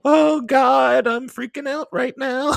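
high, loud laughing panic; mock freakout. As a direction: squeal it like you're losing it.

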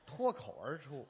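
脱口而出 said slowly and carefully, with 口 kou clear; 脱口而出 corrected with a clear kou; slow correction. Shown as a demonstration. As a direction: spell out 脱口而出 word by word to fix the slip. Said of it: "什么，什么，什么？".

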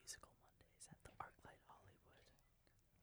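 faint slow whisper. Real, not acted. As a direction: barely breathe the words. Secret plans, hushed.

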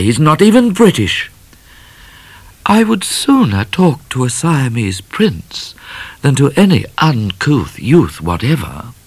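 high, tart comeback; haughty retort. A narrator's reading. Miss Laura snubs Midnight, tart and haughty. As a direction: snub him sharply.

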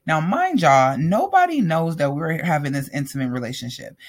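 ordinary delivery

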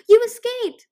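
high, loud yelp; delighted yelp. A narrator's reading. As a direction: burst out with joy at a friend's return. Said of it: "Tsunami yelped".

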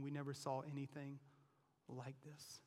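soft, trailing amazement; quiet marveling crowd; awed hush. Acted. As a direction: breathe it out in wonder.

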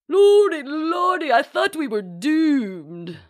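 loud, very high, drawn-out swoon; relieved duchess wail. A narrator's reading. Duchess exclaims after the close call, melodramatically relieved. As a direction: wail with theatrical relief, slowing down.

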